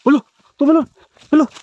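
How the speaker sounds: sudden high yell; real jolt of fright, loud and high; startled shout. Real, not acted. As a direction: shout in alarm as it leaps. Startled as monster jumps.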